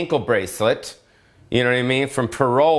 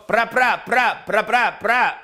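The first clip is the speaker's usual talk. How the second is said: mock gunshot sounds; 8 semitones higher, slower than usual. Imitating gangsters in the yard.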